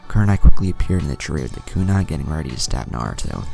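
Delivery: ordinary delivery, nothing marked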